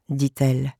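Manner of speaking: plain reading voice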